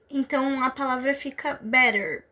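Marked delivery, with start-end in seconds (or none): 1.5-2.2 better com T como R, bɛɾer; American better. Shown as a demonstration. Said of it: "o T tem um som de R". (better americano, T vira R)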